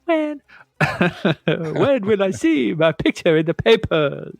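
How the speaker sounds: high, slow vampire croon, laughing; laughing through a camp vampire voice. Pretend. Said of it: "Sound like a vampire".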